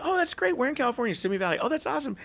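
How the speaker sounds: high, eager small talk; light up at the shared home state; excited chat. Retold or imitated. Speaker chatting with new hires from California, high and eager.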